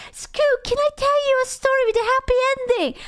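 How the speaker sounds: high, whiny mock pleading; mocking the story guy. Streamer mocking the story guy pestering her, high and whiny. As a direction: imitate the pest in a squeaky high voice. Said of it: "He's like".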